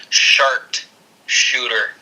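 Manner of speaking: "sharpshooter" drawn out as "shart shooter"; mocking mispronunciation. Shown as a demonstration. Explaining how her botched move was pronounced, "sharpshooter" said as "shart shooter". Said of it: "it was pronounced".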